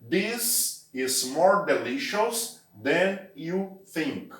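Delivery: slow, carefully spaced English sentence; slow model sentence. Shown as a demonstration. Teacher reading the example sentence slowly. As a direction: spell it out for learners. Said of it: "Então, vai ficar".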